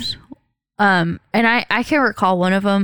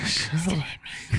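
quick, softer, lower mock-frightened squeak; mock scared; 5 semitones lower, 10 dB quieter, much faster than usual. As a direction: pretend to cower in the corner. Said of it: "shivering because I'm like".

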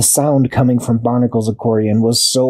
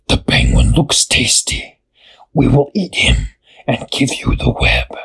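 hissing spider. A narrator's reading. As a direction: hiss it like a hungry spider. Spider threatens to eat.

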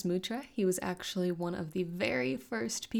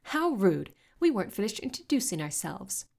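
affronted, higher-pitched outburst; offended, loud at the start; exclaiming; 4 semitones higher, 6 dB louder than usual. A narrator's reading. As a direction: protest indignantly.

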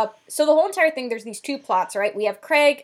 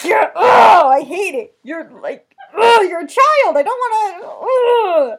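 loud, high squirming protest; cringing shout; 7 semitones higher, 11 dB louder, slower than usual. Real, not acted. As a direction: yell in mock disgust. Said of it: "put on my breathy voice".

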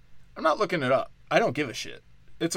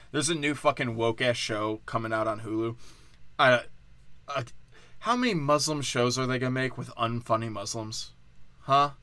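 ordinary talk, nothing marked

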